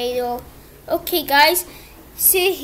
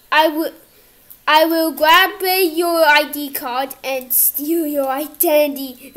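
ordinary talk, nothing marked

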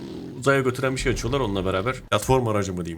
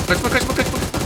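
frantic pleading; 12 semitones higher than usual. Real, not acted. Chasing the fleeing motorcyclist.

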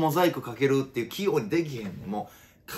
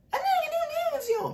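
squeaky pitched-up voice-changer voice; much faster than usual. Retold or imitated. Suspect on a police show, pitched-up voice-changer squeak. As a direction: do the chipmunk voice of a disguised suspect. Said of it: "ボイスチェンジャーでね".

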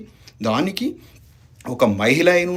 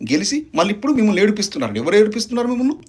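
higher, faster, rising anger; heated accusation; 6 semitones higher, faster than usual. Real, not acted. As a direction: hurl the question back at her fast and high. Heated attack on rival MLA. (ఆవేశంగా, వేగంగా, ఎత్తైన స్వరంతో ప్రశ్న)

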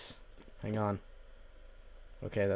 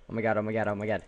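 usual speaking voice

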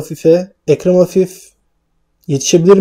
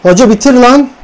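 frustrated yell; 7 semitones higher, 3 dB louder than usual. Real, not acted. Begging the game to end.

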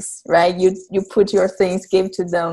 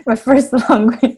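laughing; 5 semitones higher, 3 dB louder than usual. Real, not acted. Laughs at the question.

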